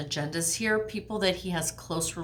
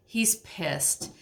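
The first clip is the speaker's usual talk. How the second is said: normal talking voice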